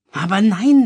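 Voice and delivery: loud, high wail, close to tears; wailing near tears. A narrator's reading. Louis wails to his mother, near tears. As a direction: whine it out, on the verge of crying. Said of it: "jammerte Louis, den Tränen nah".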